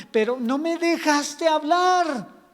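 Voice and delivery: high, loud, drawn-out complaint; God voiced as fed up, loudly; exasperated protest. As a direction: stretch it out in acted frustration. Acted God protesting at being ignored.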